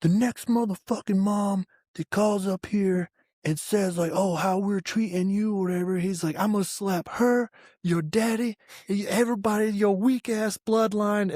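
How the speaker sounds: imitated furious drill sergeant; drill sergeant tirade. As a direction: rant like the sergeant going off in the rain. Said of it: "he just goes off".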